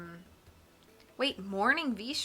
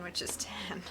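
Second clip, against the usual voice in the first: whispered aside; 4 semitones lower, 9 dB quieter, slower than usual. Real, not acted. Aside under breath.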